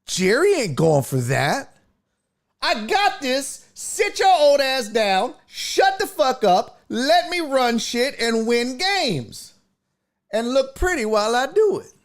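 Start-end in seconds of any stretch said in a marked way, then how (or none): 2.6-11.9 much higher, brash hammed-up swagger; cocky Dion. Host playing Dion taking over from Jerry, higher cocky swagger. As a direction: boss the old man around, mock bravado. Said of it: "That's what Dion would do".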